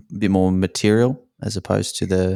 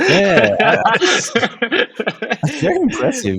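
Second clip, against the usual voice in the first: laughing; 13 semitones higher, 3 dB louder, slower than usual. Real, not acted. Laughing at the host's joke.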